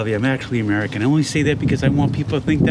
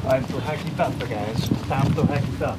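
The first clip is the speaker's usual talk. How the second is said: softer, low muttered catchphrase; quiet catchphrase; 3 semitones lower, 6 dB quieter than usual. Acted. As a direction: murmur it like a hacker to himself.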